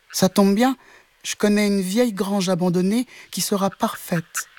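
cat suggesting a shelter; high kitten voice; helpful kitten voice. A narrator's reading. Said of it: "dit le chat".

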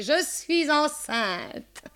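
high, very drawn-out wail; crying out. A narrator's reading. Macaré repeating her news to the landladies, a long cry. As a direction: stretch the cry right out, high. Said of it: "cria Macaré".

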